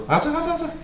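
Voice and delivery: high excited caster hype; esports caster. Acted. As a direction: call the play like a shoutcaster. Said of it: "t'avais commenté les Worlds".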